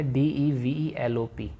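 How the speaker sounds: letters of डीईवीईएलओपी read apart, slow and even; spelled out letter by letter. Shown as a demonstration. Teacher spelling develop for students, slowly letter by letter. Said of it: "मैंने एक word लिखा आपके सामने".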